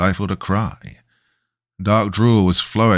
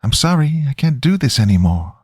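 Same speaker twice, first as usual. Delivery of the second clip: put-on high, pitiful child voice; little girl's voice; 8 semitones higher than usual. A narrator's reading. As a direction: plead like a small girl. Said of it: "it said in a little girl's voice".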